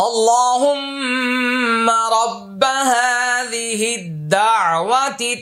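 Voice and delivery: dua recitation. Shown as a demonstration. Teacher recites the dua to students, slow melodic chant. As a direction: intone the prayer, lengthening every vowel.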